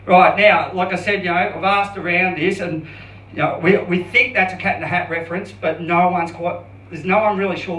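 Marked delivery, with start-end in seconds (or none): none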